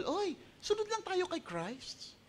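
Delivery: high, slow, breezy mock apostle voice. Pastor mocking apostles with a high, slow breezy voice. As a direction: lilt it like a careless preacher. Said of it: "hindi sinabi sa kanila ng mga apostol".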